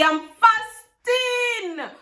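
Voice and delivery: high, very slow wail of I'm fasting; drawn-out inner plea. Retold or imitated. Pleading to the auntie in her head, drawn-out and high. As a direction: stretch it out, pleading. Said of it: "I didn't say that. That was what was going on in my head".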